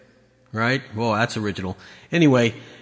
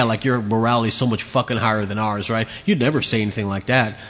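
ordinary talk

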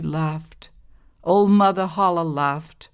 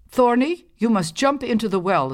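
commanding mother; 6 semitones higher, 4 dB louder than usual. Acted. Stepmother orders daughter.

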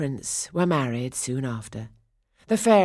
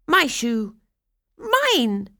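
shrill, higher and louder squabbling sisters; snatch it like a spoiled stepsister; sharp, edgy voices; 9 semitones higher, 6 dB louder, much slower than usual. Acted. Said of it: "Two voices, sharp and edgy".

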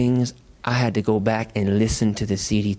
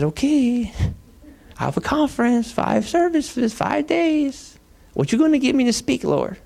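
much higher, whiny bargaining with God; pleading complaint. Retold or imitated. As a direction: beg the Lord for more material. Pleading with God about conference.

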